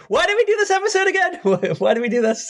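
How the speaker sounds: high, fast, laughing disbelief; real exasperation at their own pick; exasperated laugh. Real, not acted. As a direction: throw up your hands, laughing. Regretting the episode choice, laughing.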